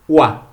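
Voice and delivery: "Wa" said loud as the French "oi", as in "croissant"; loud teaching "Wa"; French oi sound. Shown as a demonstration. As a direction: demonstrate the French "Wa" sound firmly. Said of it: "il est prononcé".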